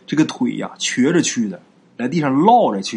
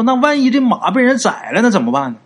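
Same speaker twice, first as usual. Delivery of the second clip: anxious, higher and louder question; worried plea; 10 semitones higher, 4 dB louder than usual. Acted. Uncle Wang fears the horse is already slaughtered, anxious and higher. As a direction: ask in sudden alarm about the horse. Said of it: "王叔说".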